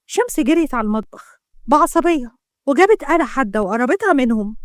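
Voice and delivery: ordinary delivery, nothing marked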